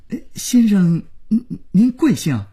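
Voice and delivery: high-pitched, stammering politeness; dazed, halting phone manner; stunned, flustered. A narrator's reading. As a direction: ask timidly after being scolded senseless. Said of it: "廖宇被骂懵了".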